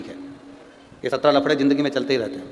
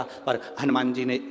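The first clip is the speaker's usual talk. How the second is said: ordinary talk, nothing marked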